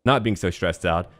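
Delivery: ordinary talk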